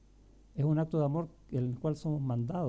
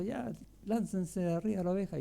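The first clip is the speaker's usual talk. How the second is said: father calling out; 4 semitones higher than usual. Retold or imitated. Dad calling to cousins from truck.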